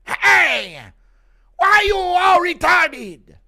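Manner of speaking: put-on very high, slow accented shout; Yoel Romero impression. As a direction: yell like a baffled Cuban coach. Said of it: "Impression of Yoel Romero joining Maori culture".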